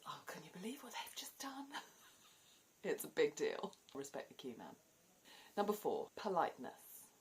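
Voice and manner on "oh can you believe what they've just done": high, whispered tut-tutting gossip; whispered shocked disapproval; scandalised whisper. Retold or imitated. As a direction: hiss it to your neighbour in the queue. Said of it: "there is".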